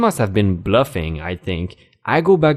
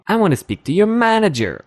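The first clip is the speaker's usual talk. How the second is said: high mock Karen demand, stressing your; 9 semitones higher than usual. Narrator mocks Karen demanding the manager, high and snooty.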